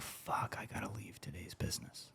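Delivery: hushed whisper of a quote, barely any voice; retold whisper. Friend whispering he's quitting his company, hushed. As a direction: breathe it out like a secret. Chaz's quiet confession retold.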